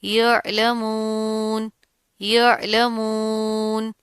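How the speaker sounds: "यअलमून" very slow, throat "अ" distinct, long "मून"; slow chanted "यअलमून", ending sustained; clear ain. Shown as a demonstration. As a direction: bring out the ain in "यअलमून" lightly, without a jolt. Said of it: "आइन की आवाज़ को आपने वाज़े".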